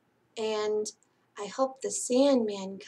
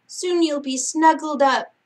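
louder, lilting read-aloud for little ones; singsong picture-book reading; bedtime story reading; 2 semitones higher, 6 dB louder than usual. Acted. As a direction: read it like a lullaby to sleepy kids.

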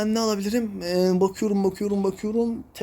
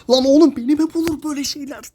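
exasperated outburst; 5 semitones higher, 4 dB louder than usual. Real, not acted. Phone lag and internet drop.

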